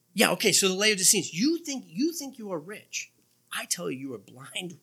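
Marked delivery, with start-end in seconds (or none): 1.3-3.1 high, soft, taunting lilt; mocking challenge. Preacher quotes Jesus to the Laodiceans, mocking. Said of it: "it's Jesus talking like that". 3.6-4.8 soft, sober pronouncement; grave verdict. Acted. Preacher quotes Jesus to the Laodiceans, soft and grave.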